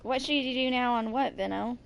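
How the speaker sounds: ordinary talk, nothing marked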